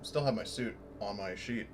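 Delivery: usual speaking voice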